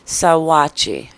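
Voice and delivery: Sawachi slow and loud, suh-WAH-chee; careful name pronunciation. Shown as a demonstration. Host pronunciating a name for a picky viewer, pointed and careful. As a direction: sound out Sawachi firmly with no g. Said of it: "I have to pronunciate that too".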